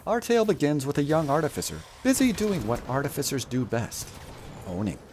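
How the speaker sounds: grand, measured fairy-tale narrator; epic story narration. Acted. Streamer narrating his game run as legend. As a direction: tell it like an ancient legend.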